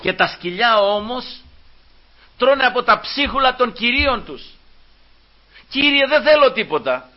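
impassioned preacher acting out a supplication; fervent pleading sermon. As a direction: plead earnestly as the woman before Christ. Preaches, voicing the woman's plea.

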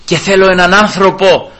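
high, loud mounting sermon climax; fervent preaching. Acted. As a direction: build it to a cry. (κορύφωση κηρύγματος, δυνατά)

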